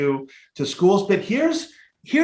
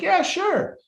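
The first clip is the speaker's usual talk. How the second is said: very high-pitched, offhand agreement; breezy retold yes. Speaker recalls accepting a job recommendation, breezy high yes. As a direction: imitate an instant yes, pitched way up.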